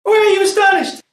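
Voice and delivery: high, ringing stage questions; challenging outcry. Acted. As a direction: throw the questions out at the astonished court. King challenges amazed courtiers in play.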